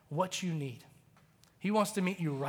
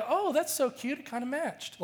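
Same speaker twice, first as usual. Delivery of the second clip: mock cute dismissal; 7 semitones higher than usual. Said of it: "It wasn't like".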